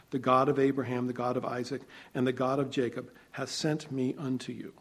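ordinary delivery

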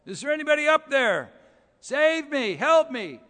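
high, loud desperate cries for help; yelling for help. Retold or imitated. Stranded man hanging over a cliff, yelling loud for help. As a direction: shout up the cliff for rescue. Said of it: "he yells out".

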